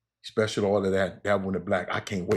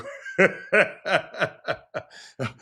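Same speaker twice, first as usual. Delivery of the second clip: a big, real, high laugh that falls away; hearty laugh; 9 semitones higher, 3 dB louder than usual. Real, not acted. Host cracking up at his friend's question, a real laugh. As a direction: let a real laugh burst out and fade.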